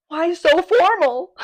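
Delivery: loud, high, drawn-out wail of protest; flustered outburst. Acted. Comic complaining about the librarian's corduroy suit, flustered outburst. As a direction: cry out in mock despair, stretching the words.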